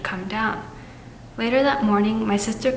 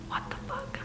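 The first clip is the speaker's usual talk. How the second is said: high breathless whispered shock; 13 dB quieter than usual. Real, not acted. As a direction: gasp it out in disbelief. Reacting to scary story twist.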